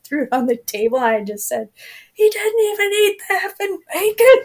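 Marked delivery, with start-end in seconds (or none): 2.1-4.4 high, loud fed-up cry; a wife venting to the crew about wasted bacon; exasperated outburst. Retold or imitated. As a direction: throw up your hands in disbelief.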